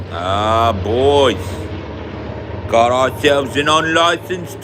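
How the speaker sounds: hammed-up British accuser, swooping pitch; play-acted tea license check; role-play license inspector. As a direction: accuse them like a put-on officious Brit. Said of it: "into the role play".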